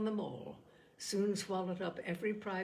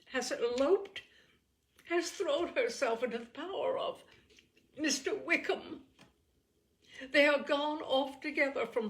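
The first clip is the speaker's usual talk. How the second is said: rising, louder voice near tears; growing higher and louder with distress; distressed outcry; 6 semitones higher, 7 dB louder than usual. A narrator's reading. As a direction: pour out the dreadful news in rising anguish. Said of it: "At length she spoke again".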